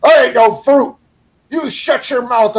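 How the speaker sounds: hammed-up offended outburst; mock indignant retort. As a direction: act out his huffy, loud comeback. Old guy's offended retort, acted.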